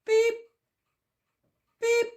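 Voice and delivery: high, short imitated electronic beeps; mimicking a little bird's single beep. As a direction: chirp it like a one-note bird. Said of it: "He'd just go".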